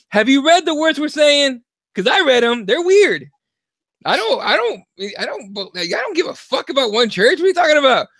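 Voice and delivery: high-pitched, worked-up protest; hammed-up exasperation, climbing high; indignant challenge. Retells challenging church teacher in class.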